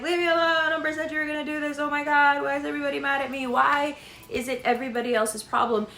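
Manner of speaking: voicing a harassed Taurus snapping back at everyone; exasperated outburst. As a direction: rattle it off like you're cornered and fed up.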